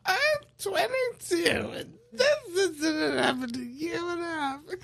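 put-on crackly old grandma voice; granny voice. Shown as a demonstration. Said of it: "it's my granny voice".

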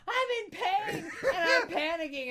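high, loud hysterical imitation; mock panic. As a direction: shriek it like a comic man freaking out. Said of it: "he's sort of hyperventilating and panicking".

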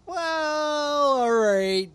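high, drawn-out "Well" easing down; hammed-up reluctant giving in; mock grudging relenting. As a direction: act like an official suddenly open to persuasion. Acts officials relenting over cash.

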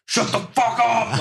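high, drawn-out mock yell; exaggerated fed-up outburst; mock angry outburst. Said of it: "Like, getting all mad".

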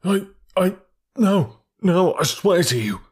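high, halting, alarmed protest; frightened stammer. A narrator's reading. Accused thief stammering denial at the detective. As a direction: stammer it out, caught off guard.

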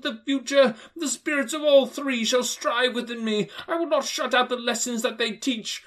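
high, loud, earnest oath; desperate old miser swearing to change; vowing Scrooge. Acted. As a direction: swear it with all his heart.

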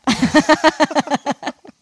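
a big real laugh; loud burst of laughter. Real, not acted. Podcaster laughing hard at her own paranoid reply.

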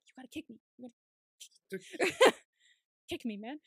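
hushed prompt breaking into a laugh; laughing whisper then burst. Retold or imitated. Actor hissing at co-star, laughing. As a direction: whisper the cue, then crack up.

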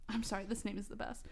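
plain reading voice

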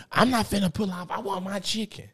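high, mock stubborn mom; mimicking mom. Son mimics mom refusing to drive off, high. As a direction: play mama refusing to leave.

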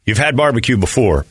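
upbeat radio ad read. Acted. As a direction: sell it like a live sponsor spot. Live ad read for sponsor.

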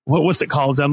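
ordinary talk

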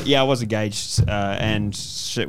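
ordinary talk, nothing marked

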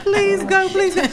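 high, loud pleading; begging. Retold or imitated. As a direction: beg urgently, hammed up. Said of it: "I begged him at two".

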